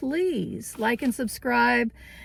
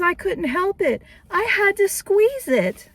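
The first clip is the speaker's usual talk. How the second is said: much higher, gushing confession; giddy lady shopper; 9 semitones higher than usual. Retold or imitated. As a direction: sound like a grown woman who just couldn't resist. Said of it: "She says".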